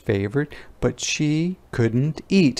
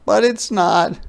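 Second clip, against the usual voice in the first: tearful confession; 10 semitones higher, 3 dB louder than usual. Acted. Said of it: "cried Ruthie".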